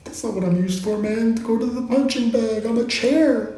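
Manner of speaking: mildly offended high talking chair; indignant chair. Retold or imitated. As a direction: set someone straight as a chair. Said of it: "it's like".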